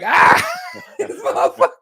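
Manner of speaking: high, loud squeal of laughter; laughing hard. Real, not acted. Podcaster retelling the chain joke, laughing hard. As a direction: crack up while you say it. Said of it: "It made me laugh so hard".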